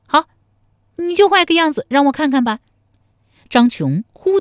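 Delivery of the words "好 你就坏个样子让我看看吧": higher, louder, fast half-serious dare; half-joking dare. A narrator's reading. 陶三春半真半假地向张琼挑战，说得半真半假. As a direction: challenge him, half in jest. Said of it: "半真半假地说".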